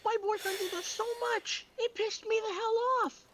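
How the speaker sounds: squeaky-high, indignant venting; worked-up complaint. Real, not acted. Venting to friends about a food-stealing boyfriend, worked up. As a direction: vent it way up high in the voice.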